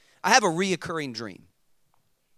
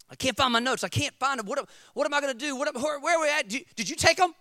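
high, fast panicked questions; mock freak-out; 8 semitones higher, faster than usual. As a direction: act out panic, piling question on question. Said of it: "I'm freaking out".

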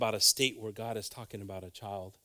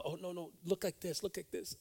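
imitating the mom; 6 semitones higher, 9 dB quieter than usual. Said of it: "The mom's telling the child".

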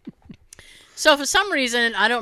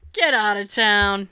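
ordinary talk

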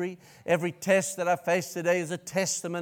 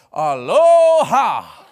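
loud, slow, high squawked greeting; parrot voice; 4 semitones higher, 14 dB louder, much slower than usual. Retold or imitated. Preacher imitates his parrot greeting him at dawn. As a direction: belt out a stretched parrot hello. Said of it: "he's like".

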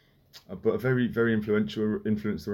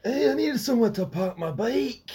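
high Scottish comic impression; Billy Connolly; 11 semitones higher than usual. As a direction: deliver a famous punchline in mock Scots. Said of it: "That famous one".

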